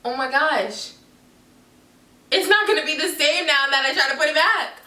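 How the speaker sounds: mock panic, pitch rising through it; inner freak-out. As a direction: act out a mild inner meltdown as the voice climbs. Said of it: "I'm literally dying on the inside".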